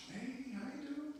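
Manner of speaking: soft, high-pitched casual hello, lightly played; casual angel greeting. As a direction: toss off a quiet, chummy greeting. Voicing a chilling angel casually.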